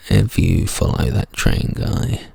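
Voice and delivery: low, forceful whisper with mock seriousness; whisper yelling. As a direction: hiss it like a grave warning. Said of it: "are you whispering into the mic".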